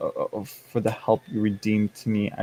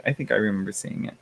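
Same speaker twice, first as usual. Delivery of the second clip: ordinary talk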